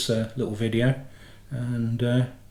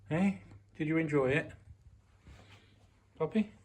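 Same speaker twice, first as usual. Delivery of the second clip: higher, softer doggy talk; pet talk; 3 semitones higher, 7 dB quieter than usual. Real, not acted. Owner greeting Poppy back from the groomers, higher and softer pet talk. Owner talks to returning dog.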